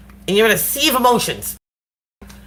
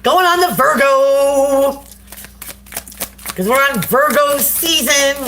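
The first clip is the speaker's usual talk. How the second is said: announcer drawl; 5 semitones higher, slower than usual. Acted. Announcing the next sign drawn out.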